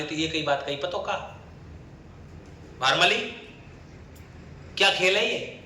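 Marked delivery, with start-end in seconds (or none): 2.8-5.6 angry. Acted. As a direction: confront her, mildly angry. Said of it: "Ranmal आया गुस्सा".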